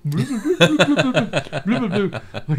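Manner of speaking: loud high bubbling through laughter; laughing bubble sounds. Retold or imitated. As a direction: bloop bloop while cracking up. Imitating divers' bubbles, laughing.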